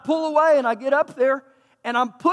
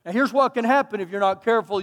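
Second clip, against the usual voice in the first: ordinary delivery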